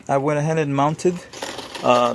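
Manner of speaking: usual speaking voice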